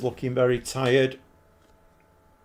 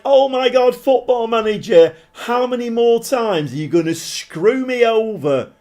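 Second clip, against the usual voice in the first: higher and louder, fed up with the game; exasperated outburst; 9 semitones higher, 6 dB louder than usual. Retold or imitated. Player venting at Football Manager, exasperated and louder. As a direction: moan at the game like it betrayed you. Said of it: "You're like".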